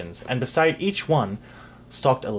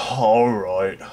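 sigh; 3 semitones lower than usual. Acted. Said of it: "sighed the executioner".

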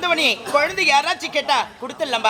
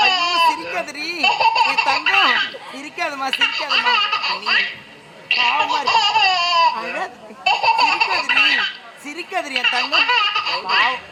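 put-on cooing to baby; 3 semitones higher than usual.

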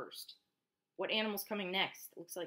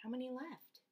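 ordinary delivery

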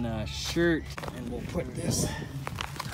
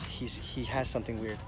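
whispering over a crib; 8 semitones lower, 13 dB quieter than usual. Real, not acted. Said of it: "We gotta be quiet".

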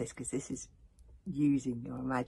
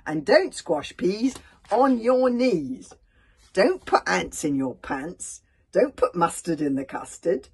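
loud, high lively recital of a silly list; poem reading; 8 semitones higher, 10 dB louder than usual. Acted. Reading a funny poem to children: loud, high, lively. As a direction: rattle off the rhymes for the children.

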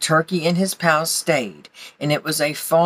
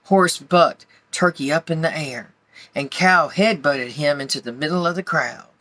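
ordinary delivery, nothing marked

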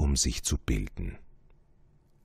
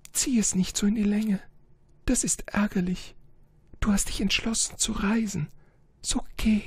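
high, delicate voice with hushed whispered lines; a proud, fragile flower saying goodbye; faster than usual. Acted. Flower urges him to leave.

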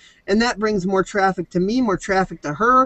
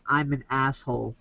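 holding nose; 5 semitones lower, 8 dB quieter than usual. Retold or imitated. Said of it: "hold your nose like this and say".

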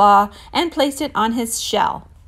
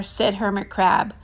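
ordinary delivery

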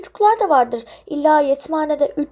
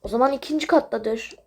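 normal talking voice

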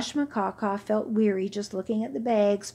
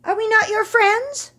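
high, loud pleading call; young camel shout; 13 semitones higher, 7 dB louder than usual. Acted. Young camel shouting to old friend, high and loud. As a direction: shout up to him. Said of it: "shouted another".

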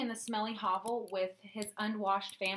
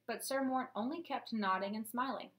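usual speaking voice

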